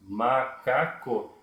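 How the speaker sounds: "macaco" slowly split as ma, ca, co; syllable by syllable. Shown as a demonstration. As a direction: say "macaco" in three long, separate syllables. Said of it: "ele fala silabicamente".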